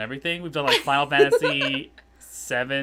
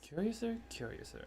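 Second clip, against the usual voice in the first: whisper; 6 semitones higher, 17 dB quieter than usual. Pretend. Repeats word again playfully.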